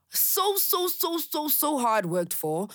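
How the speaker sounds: high, slow repeated so; drawn-out emphasis. Real, not acted. To listeners about her hard-won peace, slow emphatic so. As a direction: lean hard on each so. Stressing how hard she worked.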